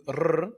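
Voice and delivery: long trilled R noise; imitated rolled R. Comic mimicking the host's rolled R's, long trill. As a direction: roll a drawn-out R on its own. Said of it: "South Africans rolled the R's".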